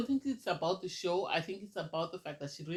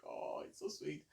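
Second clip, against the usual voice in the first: breathy whispered aside, imitating someone touched; hushed gushing; 3 semitones lower, 12 dB quieter than usual. Imitating a castmate gushing over the crying girl, whispered. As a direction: murmur it like a secret compliment.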